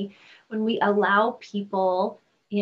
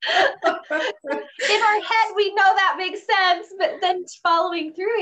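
laughing; 8 semitones higher, 6 dB louder than usual. Real, not acted. Host laughs along.

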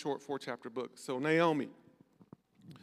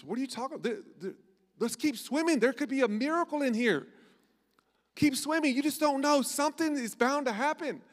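higher, louder pep talk to a sinking brother; excited urging; 9 semitones higher, 4 dB louder than usual. Acted. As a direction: cheer him on, don't give up. Brave frog urges his brother.

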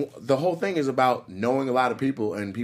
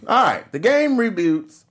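hyped announcer; 9 semitones higher, 6 dB louder, slower than usual. Acted. Hyped show intro.

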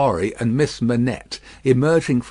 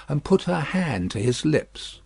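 plain reading voice

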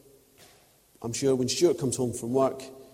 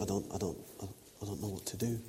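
softer, trailing self-doubt; awkward inner voice; 3 semitones lower, 11 dB quieter than usual. Retold or imitated. Preacher voicing his lost self at Mass, softer and unsure. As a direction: mumble it to yourself, lost in church. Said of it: "you feel a bit awkward and you think".

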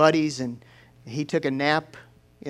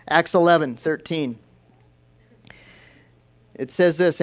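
ordinary talk, nothing marked

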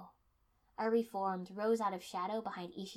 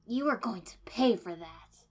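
angry; 9 dB louder than usual. Acted. Furious threat after humiliation.

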